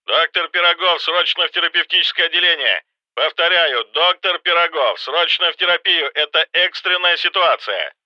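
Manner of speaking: urgent voice over a hospital intercom; loudspeaker announcement. A narrator's reading. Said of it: "По громкоговорителю... Резкий, взволнованный, на грани паники".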